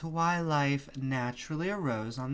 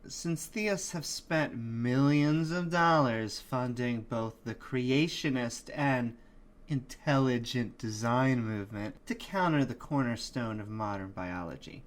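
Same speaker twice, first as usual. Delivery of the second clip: ordinary talk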